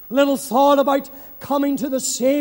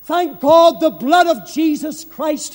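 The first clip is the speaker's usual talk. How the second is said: ordinary talk, nothing marked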